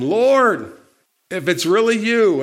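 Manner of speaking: high, loud acted call. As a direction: call out to the Lord from across the water. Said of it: "Peter called to him".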